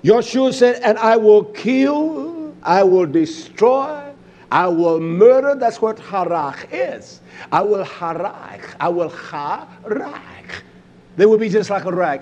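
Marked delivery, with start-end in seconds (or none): 0.8-5.6 slow quote, stretching KILL and DESTROY; drawn-out proclamation. Acted. Preacher quoting Yoshua's threat to the congregation, slow and drawn out. Emphatic quotation, stretching each verb. 7.5-10.6 slow, stretched "harach"; drawn-out emphasis. Acted. Preacher teaching what harach is, slow and drawn out. Shows the Hebrew word slowly.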